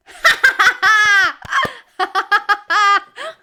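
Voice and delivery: loud burst of real laughter; delighted laugh. Real, not acted. Player cracking the Oasis clue, bursting into loud laughter. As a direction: laugh out loud at finally getting it.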